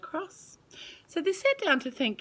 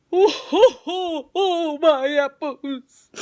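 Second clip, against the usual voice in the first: louder, higher slow lament; dismayed tree; 8 semitones higher, 11 dB louder, slower than usual. Acted. Apple tree lamenting its picked apples, loud and slow. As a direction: wail over the stolen apples. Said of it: "said the tree".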